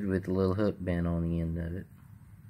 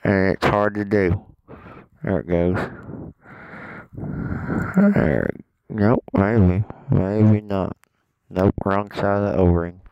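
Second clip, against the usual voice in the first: talking around a phone in the mouth; words muffled by a phone between the teeth; phone held in teeth. Real, not acted. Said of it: "I got this phone in my mouth".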